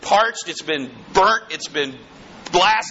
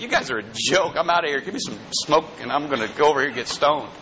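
dismissive hippies; 5 semitones lower, faster than usual. Retold or imitated. Preacher mocking sixties hippies with a lower dismissive voice. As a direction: sneer it like a bored hippie walking out. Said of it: "all the hippies said".

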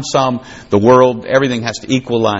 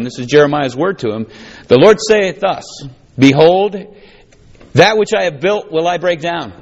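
raised, declaiming scripture; scripture reading; 6 semitones higher than usual. Acted. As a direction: proclaim it like a prophet. Reads verse four aloud.

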